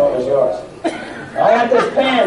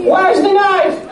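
high mock call; 11 semitones higher than usual. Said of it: "What am I saying?".

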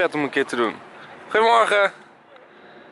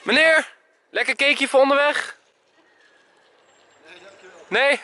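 calling out; 11 semitones higher than usual. Real, not acted. Calling out to a passing man.

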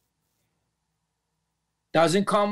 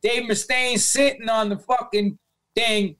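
normal talking voice

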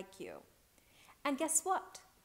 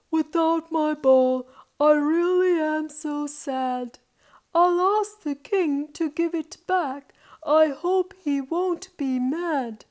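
loud, higher theatrical lament; woeful boy; 8 semitones higher, 15 dB louder, slower than usual. A narrator's reading. Boy misses his ball and dreads asking, woeful lament. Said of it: "cried Paul".

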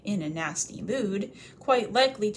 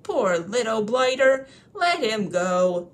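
tipsy troll pity; 2 semitones higher, 7 dB louder, slower than usual. Acted. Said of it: "he had lots of beer".

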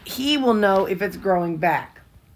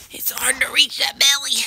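whispered pet talk; 7 semitones higher, 5 dB louder than usual. Real, not acted. Rubbing the dog's belly.

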